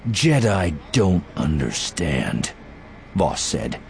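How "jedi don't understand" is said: slow, low, contemptuous sneer; dripping contempt. A narrator's reading. Voss scorns the Jedi facing him, dripping contempt. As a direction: draw it out with disdain. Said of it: "his voice dripping contempt".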